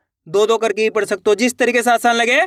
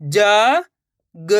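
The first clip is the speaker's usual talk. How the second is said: जा and ग each held long alone; slow, separated जा ... ग for pupils to repeat; letter by letter; much slower than usual. Shown as a demonstration. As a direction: sound out जा, then ग, with a gap. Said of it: "एक ही अक्षर का उच्चारण करके".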